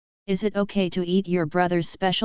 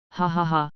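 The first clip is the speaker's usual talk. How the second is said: forced laugh. Acted. Brushing off sister's worry.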